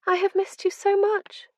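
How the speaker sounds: high, tearful and longing; breathed tearfully. A narrator's reading. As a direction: ache through tears. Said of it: "she breathed".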